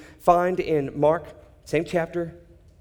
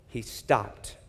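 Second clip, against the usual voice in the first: ordinary talk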